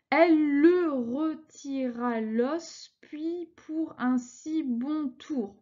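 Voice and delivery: slow, syllable by syllable verse reading; scanning syllables slowly. Shown as a demonstration. Teacher scanning a verse for her class, slow and measured. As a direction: count out each syllable of the line as you read. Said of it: "découpé les syllabes".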